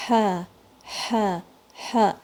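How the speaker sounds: ہا with breath flowing out like a sigh; breathy ha. Shown as a demonstration. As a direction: let the air run through ہا. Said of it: "ہا تھوڑا آپ کو آگے فیل ہوتا ہے".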